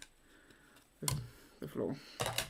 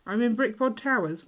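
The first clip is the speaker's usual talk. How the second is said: excited; 7 semitones higher, 8 dB louder than usual. Real, not acted. Excited announcement in game.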